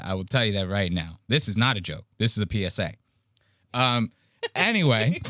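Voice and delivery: ordinary talk, nothing marked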